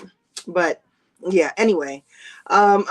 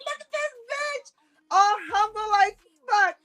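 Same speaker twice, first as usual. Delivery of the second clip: mock scream; 12 semitones higher than usual. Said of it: "that's what I be screaming".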